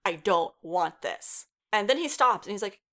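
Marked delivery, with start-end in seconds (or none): 0.1-1.5 "I don't want this" said slowly, word by word; "I don't want this", slow and deliberate; firm refusal. Retold or imitated. Retold refusal after not being heard.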